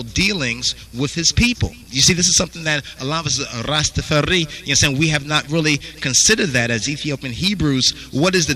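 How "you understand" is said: ordinary talk, nothing marked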